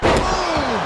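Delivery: punchy hype call; excited shout. Real, not acted. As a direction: shout it over the crowd. Excited call after big move.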